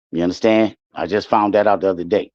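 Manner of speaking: usual speaking voice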